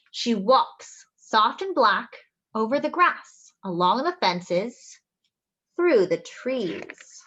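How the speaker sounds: slowing gently as she reads; storybook reading. Acted. Reading to children, slowing and gentle. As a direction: let the line slow down like a cat walking.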